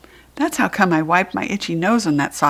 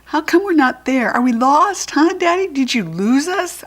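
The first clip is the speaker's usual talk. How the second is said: put-on whiny kid voice; 8 semitones higher, 4 dB louder than usual. Child pestering Daddy in car.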